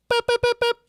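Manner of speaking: very high, loud boops; harmonic imitation. Imitating Dylan's guitar harmonics, very high. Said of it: "Dylan goes".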